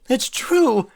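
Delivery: a high, breathy stage wail; dramatic wail. Acted. Rarity wailing dramatically over the dazed Applejack. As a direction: play the tragedy to the hilt. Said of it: "Rarity wailed dramatically".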